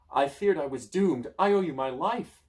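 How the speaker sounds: grateful relief. Acted. Grateful Pegasus.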